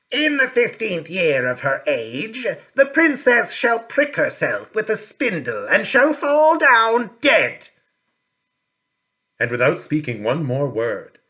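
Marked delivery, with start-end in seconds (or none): 0.1-7.7 high, loud, slow curse of a wicked fairy. A narrator's reading. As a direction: cry out the doom slowly and loudly. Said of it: "she cried with a loud voice".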